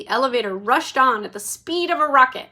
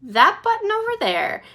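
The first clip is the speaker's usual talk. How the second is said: much higher, bright announcing; pointing out eagerly; 10 semitones higher, 5 dB louder than usual. A narrator's reading. Wonka pointing the guests to the button, excited. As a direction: show it off with delight. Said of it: "Mister Wonka said".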